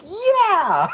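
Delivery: high, loud whoop of victory; triumphant yell. Acted. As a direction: cheer like a giant warrior. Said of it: "Vidar's gonna be like".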